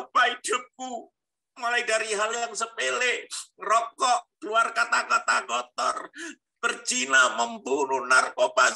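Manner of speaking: high, impassioned shouted prayer; crying out to God. Retold or imitated. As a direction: cry out a desperate plea at high pitch. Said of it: "Saya teriak".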